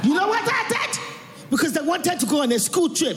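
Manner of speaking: high-pitched, scolding Nigerian-mother impression; mother's Nigerian accent. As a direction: drive the warning home in mum's Nigerian accent. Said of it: "And she'll be like that".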